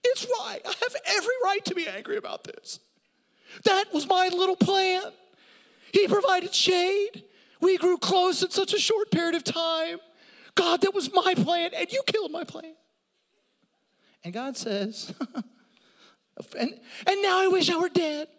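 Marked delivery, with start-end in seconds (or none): 0.0-12.8 very high, loud whiny Jonah; hammed-up Jonah tantrum; whining Jonah. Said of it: "He's like". 17.1-18.3 very high, loud whiny Jonah; hammed-up Jonah tantrum; whining Jonah. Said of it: "He's like".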